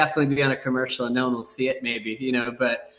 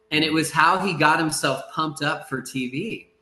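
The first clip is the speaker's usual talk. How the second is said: ordinary delivery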